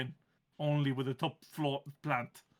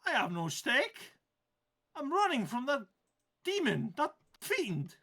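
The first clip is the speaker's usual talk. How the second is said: grumpy plant voice; 9 semitones higher than usual. Acted. GM holds plant voice.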